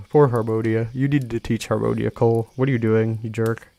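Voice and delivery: mock outrage at Cole; mock scolding. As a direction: tease Cole like a jerk.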